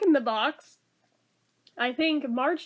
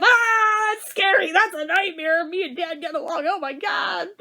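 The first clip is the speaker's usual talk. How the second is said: higher, louder hammed-up scared wake-up; mock terror; 4 semitones higher, 4 dB louder than usual. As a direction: wail like waking from a nightmare. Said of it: "this is when Bart wakes up".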